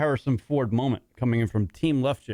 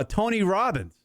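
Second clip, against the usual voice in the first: ordinary talk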